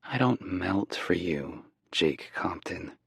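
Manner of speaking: slow, soft whispered murmur. Acted. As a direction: breathe it out slowly under the voice. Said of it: "she murmurs".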